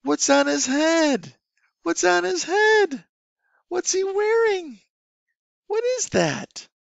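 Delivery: put-on high, slow, sing-song questions to a small child; teacher voice for kids. Shown as a demonstration. Online teacher asking a young student, sing-song and high. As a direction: ask it like a playful teacher to a toddler. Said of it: "I say".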